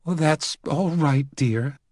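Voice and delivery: put-on gracious elderly lady reply. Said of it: "a little out of breath".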